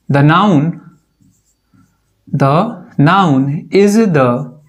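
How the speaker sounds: very slow, with long pauses between phrases; slow dictation. Shown as a demonstration. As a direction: dictate it in small separate chunks. Dictating a memorised definition word by word. (रुक-रुक कर, धीरे-धीरे बोलते हुए)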